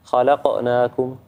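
خلقناكم said slowly with a bounce on the ق; qalqala demonstrated. Shown as a demonstration. Teacher showing the qalqala on the qaf, slow recitation. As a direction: let the stopped ق in خلقناكم echo slightly. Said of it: "نسوي هالقلقلة".

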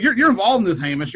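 ordinary talk

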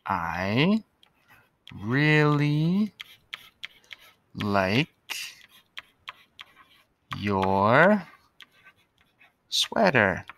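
each word stretched and spaced; slow dictation. Shown as a demonstration. Teacher writing on the board, word by word. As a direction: dictate it slowly as you write.

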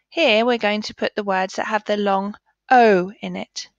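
normal talking voice

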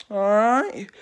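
punchy rallying all right. Shown as a demonstration. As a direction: shout "All right?" to get attention. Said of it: "repeated all right".

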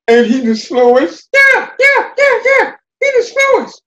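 very high, loud squeaky mocking chorus; jeering critters. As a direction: jeer at him high and loud. Critters jeer at Terrapin.

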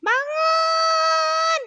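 high, loud, drawn-out cat yell; cat's shout. Pretend. Cat wakes the sleeping baby, high shouting cat voice. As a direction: shout a wake-up call as a shrieky little cat. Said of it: "Bilang dibangunin sama kucing aja".

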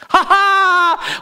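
high, acted "ha ha"; villainous spider laugh. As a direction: gloat with a mock spider cackle. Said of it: "laughed the spider".

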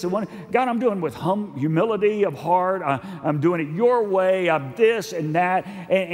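high-pitched, emphatic preacher delivery; animated preaching. Real, not acted. Preacher to congregation on scoundrels who prosper, animated.

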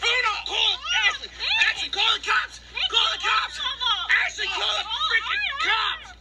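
yelling for help. Real, not acted. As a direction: scream for someone to call the cops.

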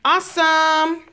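high, happy cheer; excited praise. Real, not acted. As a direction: cheer her on. Praising a child at play.

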